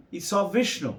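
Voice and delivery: usual speaking voice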